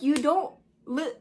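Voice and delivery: mock disbelief at what she's hearing; baffled reaction. Reacting to the streamer's meltdown with mock disbelief. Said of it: "and I'm like".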